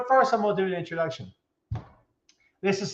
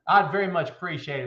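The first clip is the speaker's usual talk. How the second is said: normal talking voice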